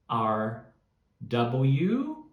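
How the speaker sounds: usual speaking voice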